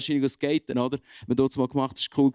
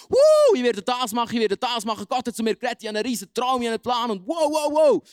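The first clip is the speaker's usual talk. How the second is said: high, loud, gushing excitement; hammed-up thrilled believer shouting wow; mega enthusiastic; 8 semitones higher, 4 dB louder than usual. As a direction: bubble over with a big dream, louder and louder. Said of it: "mega enthusiastisch gewesen, begeistert gewesen".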